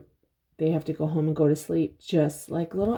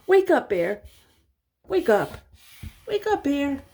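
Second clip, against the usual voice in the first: high, louder wake-up calls; calling out; 8 semitones higher, 3 dB louder than usual. Acted. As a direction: call to wake a sleeper. Owl calling sleeping bear.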